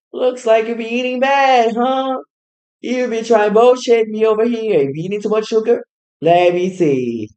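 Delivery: high, loud teasing scold about sugar; scolding caretaker. Retold or imitated. Caretaker scolding mom about eating bad, high and loud. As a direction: wag a finger at a naughty adult kid over the phone.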